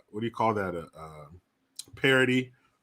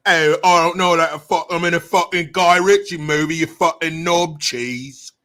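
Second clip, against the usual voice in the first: put-on loud, higher Guy Ritchie movie British accent; hammed-up London gangster, British accent; 5 semitones higher, 7 dB louder than usual. Streamer showing another British accent, loud Guy Ritchie gangster accent. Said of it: "And then you have".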